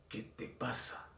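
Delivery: hushed, threatening whisper; menacing whisper. Retold or imitated. Boy whispering a threat to a classmate, menacing. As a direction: whisper it like a threat before a fight. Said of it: "sonó como una amenaza".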